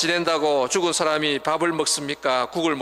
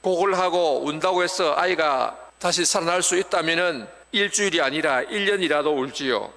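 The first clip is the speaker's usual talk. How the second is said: ordinary talk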